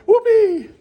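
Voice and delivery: a high, loud whoop; mock cheer. Real, not acted. Mock cheer after self-mocking laugh.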